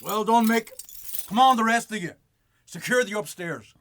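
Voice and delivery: loud commands to the crew; barked orders. Acted. Bailiff commanding his crew during the raid, loud orders. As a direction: order the men upstairs loudly.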